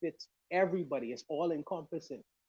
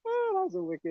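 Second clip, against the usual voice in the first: much higher, louder, laughing exclamation; 8 dB louder than usual. Real, not acted. Reacting to a brother's joke in the chat, laughing. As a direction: say it through real laughter.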